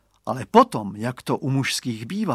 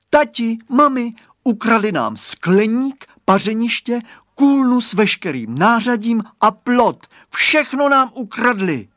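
high, loud panicked cry to parents; little boy calling out in alarm; alarmed child; 10 semitones higher, 9 dB louder than usual. A narrator's reading. Radovan calling his parents about the vanished garden, alarmed shout. Boy thinks everything was stolen.

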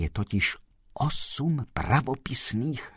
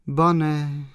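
higher, mildly mournful reply; sad; 7 semitones higher, 6 dB louder than usual. A narrator's reading. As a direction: answer with a touch of sadness, pitched up. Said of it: "pravila smutně slečna Mařenka". (mírně smutně, vyšším hlasem)

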